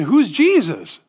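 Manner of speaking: high, loud, puzzled mock voice; incredulous "who's this guy" voice; mock confused goat. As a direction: play the clueless doubter, hammed up. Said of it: "the goats came up and said".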